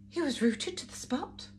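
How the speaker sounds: ordinary delivery, nothing marked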